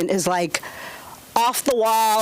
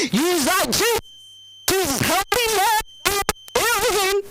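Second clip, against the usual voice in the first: mock desperate prayer; 9 semitones higher, slower than usual. Frantic wife calling on Jesus for help. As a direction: cry out to the Lord, hammed up. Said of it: "I started, like, praying".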